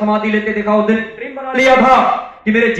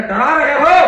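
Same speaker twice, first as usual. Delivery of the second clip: "डरा रहे हो?" thrown back louder and higher with a rising tune; defiant challenge; 4 semitones higher, 4 dB louder, slower than usual. Acted. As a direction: challenge the threat head-on. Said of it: "Ashtavakra कहते".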